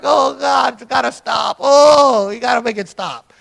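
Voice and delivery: high, strained mock plea; helpless-laughter plea. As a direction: beg for relief as if laughing until it hurts. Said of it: "laughed so hard, my head was just hurting".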